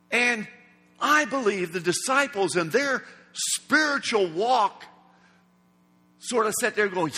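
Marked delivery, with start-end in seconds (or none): none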